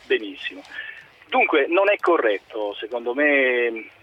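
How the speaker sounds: ordinary talk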